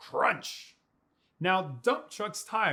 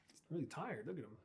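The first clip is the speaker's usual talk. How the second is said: whispering; 6 semitones lower, 15 dB quieter, much faster than usual. Real, not acted. Aside about sleepy truck.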